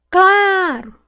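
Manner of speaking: "Claro" with a loud, high, long CLA; stretched stressed syllable. Shown as a demonstration. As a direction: call out "Claro", holding CLA. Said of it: "Qual que eu demorei mais falar".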